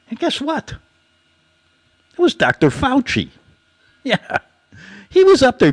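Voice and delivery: high, gleeful conspiracy reveal; spring the punchline with glee; excited reveal. Real, not acted.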